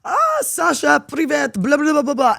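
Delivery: loud, high mock-Russian greeting; excited Russian fans. Russians from TikTok greeting their idol, loud excited mock babble. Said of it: "they'll be like".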